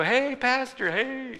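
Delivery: high, chirpy hello from a churchgoer; cheerful greeting. Retold or imitated. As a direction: imitate a bright congregant calling out. Congregants greeting the pastor cheerfully.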